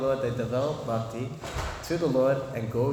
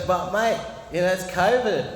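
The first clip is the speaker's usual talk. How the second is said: put-on raised-pitch broad Australian Bogan accent; 6 semitones higher, 4 dB louder than usual. Pub drinker telling the bookseller about payment, mock Bogan accent.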